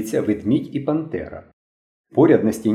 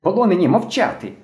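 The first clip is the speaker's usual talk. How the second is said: put-on high-pitched boy giving orders; shouted command; 7 semitones higher than usual. A narrator's reading. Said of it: "закричав білобрисий".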